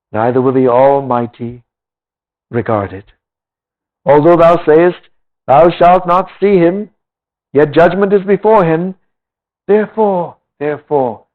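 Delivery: measured, solemn Bible reading; scripture reading. Acted. Preacher reading Job to the congregation, solemn scripture voice. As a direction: read the old words with weight. Reads Job aloud in sermon.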